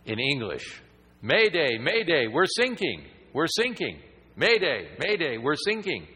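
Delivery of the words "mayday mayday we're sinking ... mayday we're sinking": urgent high shouted mayday call; loud frantic radio mayday; distress call. Retold or imitated. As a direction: call it like a panicked radio operator. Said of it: "all of a sudden you hear".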